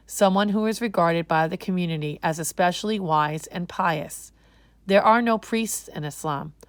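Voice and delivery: ordinary delivery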